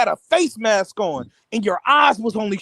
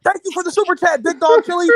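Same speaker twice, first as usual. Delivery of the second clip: high-pitched mock streamer shout-out; Fanon; 9 semitones higher than usual. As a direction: read out thanks like an excited host. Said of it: "he said".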